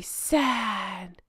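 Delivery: soft, quiet, droopy mock-sad sigh of a word. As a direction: sell the fake sorrow, softly. Mocking her staged sadness.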